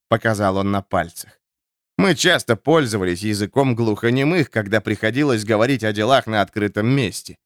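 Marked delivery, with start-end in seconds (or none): none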